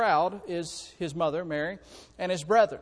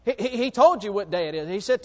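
ordinary talk

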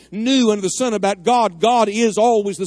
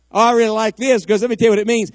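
ordinary talk

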